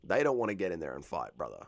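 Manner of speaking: soft, sing-song sarcastic gushing; hushed, hammed-up fanboy mockery; mock awe. Mocking hype about hardcore fighters.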